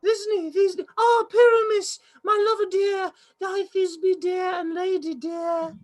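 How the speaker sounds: tiny monster trying fierce; squeaky falsetto maiden, hammed-up; monstrous little voice. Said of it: "a monstrous little voice".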